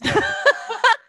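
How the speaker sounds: bright burst of laughter; high laugh. Real, not acted. Laughs at own joke.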